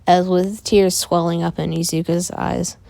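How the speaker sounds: plain reading voice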